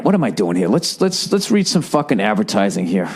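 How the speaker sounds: normal talking voice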